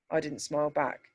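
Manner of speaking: plain reading voice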